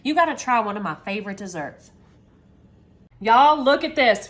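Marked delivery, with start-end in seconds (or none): 3.2-4.3 loud, slow, thrilled call to the viewers; loud, drawn-out y'all, look at this; excited reveal. Real, not acted. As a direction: show it off with a big proud call. Unveiling the cheesecake.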